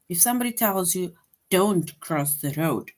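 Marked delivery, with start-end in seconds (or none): 1.5-2.9 slow firm command, DON'T stressed. Shown as a demonstration. Teacher acting out someone giving an order, slow and firm. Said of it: "they are commanding you".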